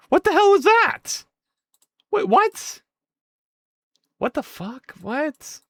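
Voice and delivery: very high startled cries; real disbelief; shocked. Real, not acted. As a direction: react to a glitch. Fast animation glitch.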